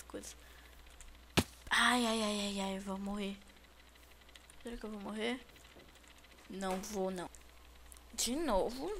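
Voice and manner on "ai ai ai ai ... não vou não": ordinary delivery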